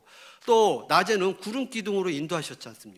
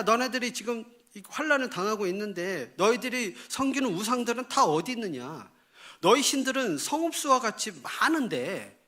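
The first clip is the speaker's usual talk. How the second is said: higher-pitched, pressing scolding questions; divine rebuke; 6 semitones higher than usual. Acted. God asks Israel where their idols are, rebuking. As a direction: voice God reproaching wayward people. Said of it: "하나님이 이제 책망을 하시는데요".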